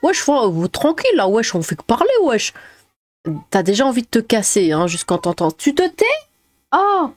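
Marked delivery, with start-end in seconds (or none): 0.0-2.5 imitating a racaille from the suburbs, wesh; lightly voiced banlieue street accent. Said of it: "son accent racaille". 5.5-7.1 high-pitched playful scold; high mock scolding.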